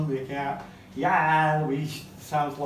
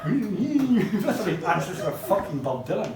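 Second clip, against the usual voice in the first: ordinary delivery, nothing marked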